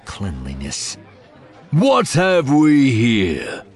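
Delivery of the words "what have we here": slow, loud brutish growl. A narrator's reading. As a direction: growl it like a thug officer. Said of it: "comes a brutish growl of a voice".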